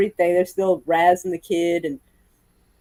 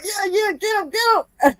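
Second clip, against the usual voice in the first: excited cheering; 13 semitones higher, slower than usual. Retold or imitated. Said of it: "He's going".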